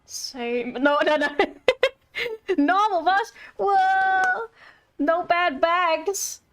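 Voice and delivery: ordinary talk, nothing marked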